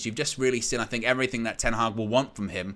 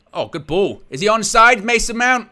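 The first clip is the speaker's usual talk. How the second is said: excited; 7 semitones higher, 9 dB louder than usual. Real, not acted. Live chance, checking offside.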